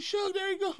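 very high squeaky mock voice; Cat Williams. Comedian mimicking his rival calling to a powerful friend, mocking falsetto. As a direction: imitate a rival in a thin falsetto.

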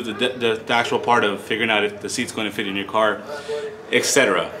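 winded, breathing hard between words. Real, not acted. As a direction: talk while catching your breath. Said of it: "catch my breath".

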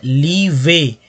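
"Leeway" drawn out slowly as ली-वे; syllable by syllable. Shown as a demonstration. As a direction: stretch both syllables of "leeway" for learners. Teacher breaks a word into syllables.

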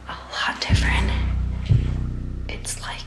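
hushed, frightened whisper. Real, not acted. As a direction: whisper so nothing hears you. Whispering alone in haunted basement.

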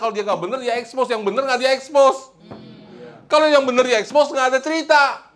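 high, forceful sermon voice; impassioned preaching. Real, not acted. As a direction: drive the point home to the congregation.

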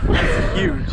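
breathy, high rush of joy; thrilled gasp over the catch; excited. Real, not acted. Big catch landed.

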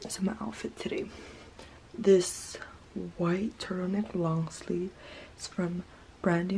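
soft whisper. Real, not acted. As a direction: keep your voice down. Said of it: "so I'm gonna try and whisper".

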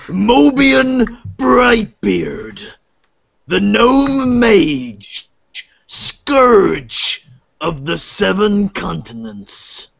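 slow, grand storyteller intro; grand intro narration. Acted. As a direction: announce the heroes like a tale begins. GM introduces the heroes.

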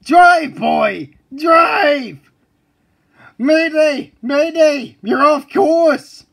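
high, loud panicked shrieks; terrified screaming. Acted. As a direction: scream in total terror.

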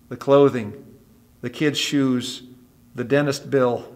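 ordinary talk, nothing marked

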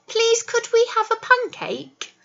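high, sweet polite request; polite asking. Shown as a demonstration. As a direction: show nice manners. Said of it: "We would probably say".

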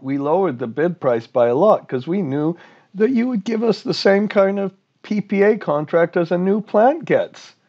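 high, insistent acted argument; aggrieved plant owner. Owner acted arguing with regulator.

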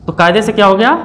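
usual speaking voice

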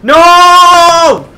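long, high, full-volume yell; frustrated yell. Real, not acted. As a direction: howl it out in real frustration. Rage at losing in game.

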